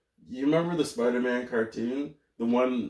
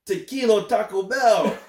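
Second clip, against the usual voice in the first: mock Spanish accent; 6 semitones higher, 4 dB louder than usual.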